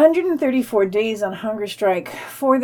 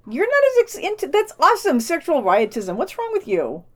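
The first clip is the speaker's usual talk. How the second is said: high squeal of delight; pitched way up, thrilled; excited; 7 semitones higher, 5 dB louder than usual. Real, not acted. Host reacts to the word sexual riotism, excited.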